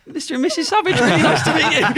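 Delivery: can't keep a straight face while being polite; laughing through it. Retold or imitated. Player forced to be polite.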